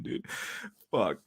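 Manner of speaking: usual speaking voice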